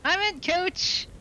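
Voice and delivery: high, slow, eager shout of a keen rookie; gung-ho rookie reply. Acted. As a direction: answer the coach like a fresh recruit. Rookie answering the coach.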